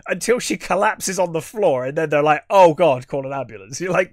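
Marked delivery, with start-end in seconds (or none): 2.5-3.8 loud retold cry of alarm; mock alarm. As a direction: imitate a startled crew, suddenly loud. Retold crew panic as she collapses.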